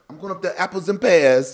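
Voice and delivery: loud, high put-on Cockney; mock Cockney accent. American fighter imitating how Cockneys talk, loud mock Cockney accent. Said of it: "they the ones be saying".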